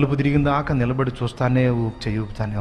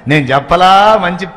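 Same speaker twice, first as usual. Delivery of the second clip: gleeful shout; 8 semitones higher, 9 dB louder than usual. Acted. Uncle gloats poison worked.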